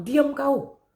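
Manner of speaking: high mock order to hush; scolding bystander. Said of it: "Semakin orang bilang".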